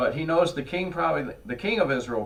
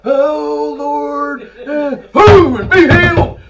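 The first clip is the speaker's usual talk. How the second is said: high, loud, stretched-out faith healer cry; mocking faith healer wail; 11 semitones higher, 13 dB louder, much slower than usual. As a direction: belt it like a televangelist healing. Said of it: "sound like the faith healers".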